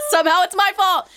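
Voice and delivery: high and loud mock indignation; exasperated outburst. Real, not acted. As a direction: throw it up in comic exasperation. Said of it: "laughing at her own anxiety".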